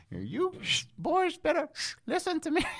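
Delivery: high-pitched mock fury, whistling through the teeth; hammed-up angry principal with a huge whistle on the sibilants; Mister Shin, angry whistling principal. Imitating the old principal scolding the boys at assembly, high whistly mock anger. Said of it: "he was just getting so angry".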